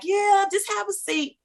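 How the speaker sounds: high, drawn-out brush-off; dismissive desk lady. Retold or imitated. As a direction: imitate a receptionist slowly waving them off, high-pitched.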